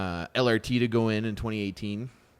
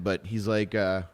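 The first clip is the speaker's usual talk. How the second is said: ordinary delivery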